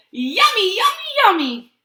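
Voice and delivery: high, loud gleeful yummy; thrilled cartoon bird; excited pigeon. A narrator's reading. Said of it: "Look how excited he is".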